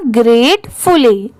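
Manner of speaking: slow GREAT-FULLY, gratefully split in halves; word in two parts. Shown as a demonstration. Teacher joins the halves for pupils, slow split word. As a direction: say gratefully slowly, half by half. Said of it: "पूरा मिला देंगे तो".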